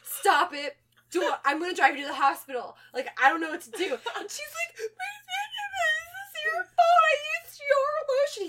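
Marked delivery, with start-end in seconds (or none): 0.0-3.8 loud, flustered pleading; mock panic. Boyfriend offering the hospital, loud mock panic. 4.8-8.4 very high, squealing hammed-up sobs; fake crying wail. Girlfriend blaming her boyfriend's lotion, hammed-up crying. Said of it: "fake crying and freaking out".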